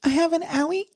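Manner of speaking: put-on very high-pitched little girl goose. Auditioning a voice for a goose, very high girly voice. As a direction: squeak it out as a helpless girl goose. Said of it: "if it was a girl goose".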